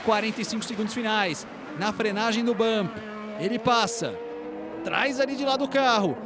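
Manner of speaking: building live play-by-play, rising toward the finish; motorsport commentator. Acted. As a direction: call the lap live, tension building. Live qualifying lap call.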